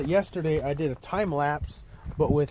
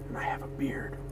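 whispered mock pride; 2 semitones lower, 12 dB quieter than usual. Mock patriotic boast, whispered.

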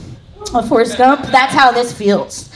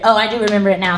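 ordinary talk, nothing marked

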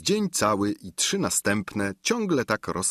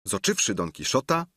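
plain reading voice

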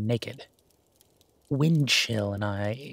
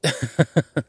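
loud, short burst of laughter; sudden higher-pitched laugh; quick laugh; 4 semitones higher, 10 dB louder than usual. Acted. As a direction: laugh it off briefly. Laughs off being teased as romantic.

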